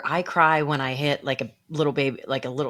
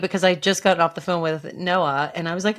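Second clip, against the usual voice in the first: usual speaking voice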